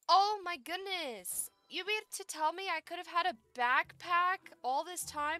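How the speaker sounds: high, loud astonished cry; amazed. Real, not acted. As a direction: gasp at the discovery. Finding backpack.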